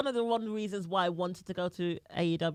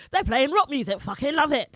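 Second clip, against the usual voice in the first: higher, louder burst of fan glee; excited fan reaction; 8 semitones higher, 8 dB louder than usual. Acted. Host voicing a fan hearing rock entrance music, excited. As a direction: blurt it out like a thrilled fan. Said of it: "it's just something that you was like".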